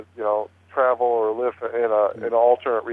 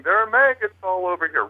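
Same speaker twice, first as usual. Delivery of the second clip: higher-pitched, only mildly louder retelling; retold loud line; 7 semitones higher than usual. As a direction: lightly imitate a ghost voice shouting. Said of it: "real loud".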